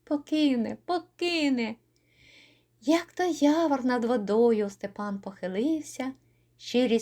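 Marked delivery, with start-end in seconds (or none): 0.0-1.8 a touch of tearful repetition; through tears. A narrator's reading. Yaryna weeps that Stepan will leave her, tearful. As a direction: murmur it through mild tears. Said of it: "крізь сльози промовляє".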